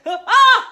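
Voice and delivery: high imitated guffaw; mock laughing fit. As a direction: imitate someone helplessly laughing. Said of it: "he was just laughing".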